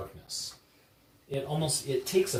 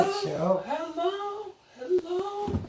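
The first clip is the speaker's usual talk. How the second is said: high, distant echoing call; echoing hello; slower than usual. Acted. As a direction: call out like a cave echo. GM voices the echo.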